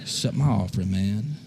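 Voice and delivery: low, quiet sulking mutter; sulky Cain. Acted. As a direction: mumble it like a pouting kid. Cain pouting, mocked.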